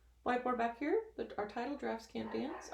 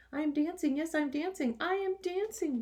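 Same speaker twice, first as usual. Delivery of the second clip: higher, louder joyful cry; excited cry; 9 semitones higher, 4 dB louder than usual. Acted. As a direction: shout it out with pride and joy. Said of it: "Gerald cried".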